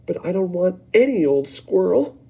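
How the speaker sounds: put-on a child refusing second best; Veruca protesting. Veruca protesting to her mother, high and bratty. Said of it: "Veruca shouted".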